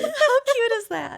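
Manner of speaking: high, slow squeal through laughter; real delighted squeal; laughing squeal. Real, not acted. Reacting to cute kid story.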